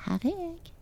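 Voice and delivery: high squeaky little voice; very high, teasing little character; tiny thumb-sized boy. A narrator's reading. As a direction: peep out from a hiding place. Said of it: "og han sa".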